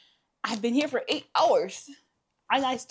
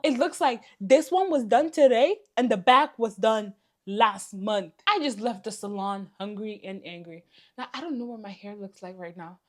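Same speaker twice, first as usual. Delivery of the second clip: usual speaking voice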